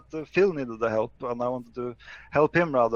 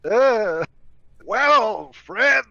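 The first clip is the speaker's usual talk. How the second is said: higher, louder mock of his buddy; hammed-up, higher and louder take on his pal; impression of friend; 6 semitones higher, 6 dB louder, much slower than usual. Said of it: "that's what I sound like?".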